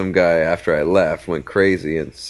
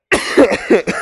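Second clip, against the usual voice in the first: hacking smoker's cough; 13 semitones higher, 4 dB louder than usual. Retold or imitated. Smoker's laugh ends in cough.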